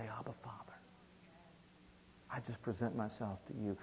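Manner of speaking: very soft, humble prayer; intimate prayer. Shown as a demonstration. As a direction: drop almost to a whisper. Models intimate prayer to God.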